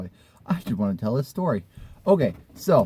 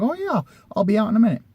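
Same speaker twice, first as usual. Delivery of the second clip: high, put-on woman's voice; high mock voice of the friend; imitating the friend; 5 semitones higher than usual. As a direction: play her airy excuse. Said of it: "And she goes".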